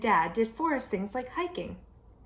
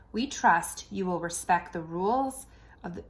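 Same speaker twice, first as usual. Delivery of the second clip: usual speaking voice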